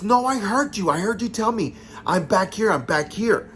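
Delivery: acted-out heated insistence; insistent protest. Retold quarrel, insisting she heard.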